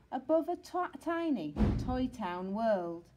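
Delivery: ordinary delivery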